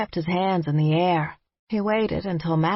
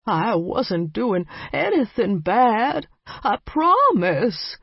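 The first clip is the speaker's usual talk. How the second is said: put-on slow, much higher pleading old voice; old vampire pleading with hands raised; pitiful pleading; 5 semitones higher, slower than usual. A narrator's reading. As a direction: beg for mercy, pitifully. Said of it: "a voice dripping with pity".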